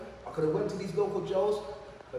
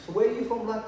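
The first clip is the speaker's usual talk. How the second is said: lightly imitated odd British accent, pitched higher; louder, mock regional-accent challenge; mad regional accent; 6 semitones higher, 6 dB louder than usual. Cellmate questions the new London prisoner, mad accent. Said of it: "some mad, not even a Scouse accent, some mad accent".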